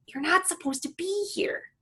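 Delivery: put-on high, gently scolding girl. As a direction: hush a stowaway pet. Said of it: "she reminds him".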